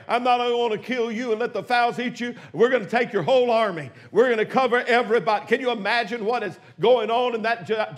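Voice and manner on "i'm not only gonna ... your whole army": usual speaking voice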